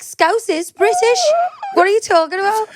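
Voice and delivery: mock outrage at the daughter; incredulous. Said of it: "I'm like".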